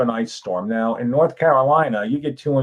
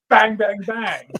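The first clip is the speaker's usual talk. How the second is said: mock gunshots; 8 semitones higher, slower than usual. Imitated gunshots.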